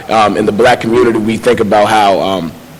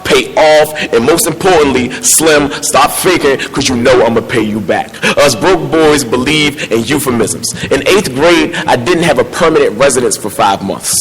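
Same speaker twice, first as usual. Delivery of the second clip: spoken word poem; 5 semitones higher than usual. Acted. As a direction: perform it like a slam poem. Performs his poem.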